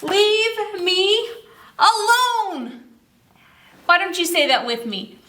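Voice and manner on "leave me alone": high, loud shout of leave me alone; a fed-up old woman shouting back as she leaves. Acted. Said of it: "she shouted back".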